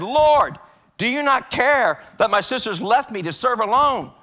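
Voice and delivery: loud, high, slow protest to the Lord; indignant complaint. Acted. Martha protests to Jesus that her sister left her, loud indignant complaint. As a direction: demand an answer, offended.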